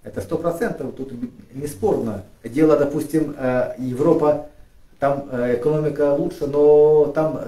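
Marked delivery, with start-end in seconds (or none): none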